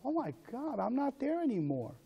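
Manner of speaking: high, soft, wondering exclamation; acted amazed realization. You'll say.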